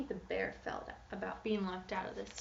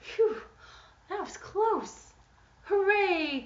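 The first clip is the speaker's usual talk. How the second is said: high, loud, relieved princess cheer; 10 semitones higher, 9 dB louder, slower than usual. Acted. Saved princess, high loud relieved cheer. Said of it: "cried the princess".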